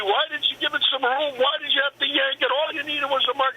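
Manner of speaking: high-pitched mock yell at his partner; high-pitched mock yell scolding a missed mark; retold yelling. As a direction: imitate a frantic partner shouting in pitch, not volume. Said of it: "He's yelling at me".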